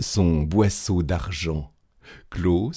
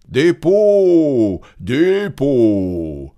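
loud, high hawking with drawn-out calls; street vendor's cry; 8 semitones higher, 6 dB louder, slower than usual. A narrator's reading. Grand Claus hawking hides through the streets, loud vendor's cry. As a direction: call your wares down the street. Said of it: "cria-t-il dans toutes les rues".

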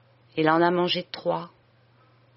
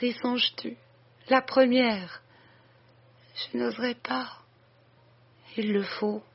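higher, anguished voice of a dying woman; dying sister's confession; 4 semitones higher than usual. Acted. Dying woman begging her sister and the priest for forgiveness, anguished and higher. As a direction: plead out each line as if breath is running out.

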